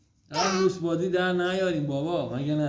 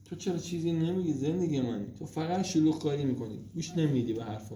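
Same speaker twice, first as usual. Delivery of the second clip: ordinary talk, nothing marked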